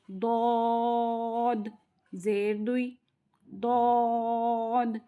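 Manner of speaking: each ضاد drawn out, vowel names read slowly; slow drill recitation. Shown as a demonstration. Teacher leading the class in a harakat drill, slowly. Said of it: "مشق کر لیتے ہیں".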